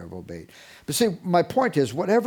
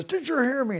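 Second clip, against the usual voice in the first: mock shriek of a fed-up mother, pitched way up; mother screaming; 11 semitones higher than usual. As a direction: shriek it like an exasperated mom. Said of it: "when mother screams and she says".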